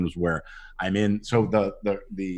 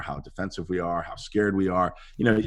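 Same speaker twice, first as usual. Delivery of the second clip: usual speaking voice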